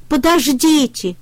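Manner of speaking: calling out. A narrator's reading. Трактор кричит утятам подождать. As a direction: call after the ducklings. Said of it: "крикнул трактор".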